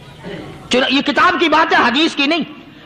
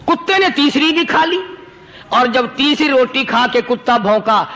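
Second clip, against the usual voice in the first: ordinary talk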